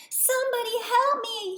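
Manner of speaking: high, loud desperate call; stranded frog shouting for help. Acted. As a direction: shout for help over the storm. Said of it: "he shouted".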